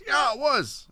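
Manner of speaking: high, emphatic imitated answer; keen retold reply. Host acting out the lady's answer about the rally, keen and high. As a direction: mimic an enthusiastic yes, pitched high.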